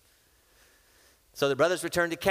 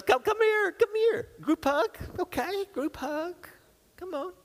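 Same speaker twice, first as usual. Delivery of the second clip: much higher, eager come-here call; joyful calling. Acted. Joseph calling his brothers into a group hug, much higher and eager. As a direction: beckon them in for a group hug, overjoyed.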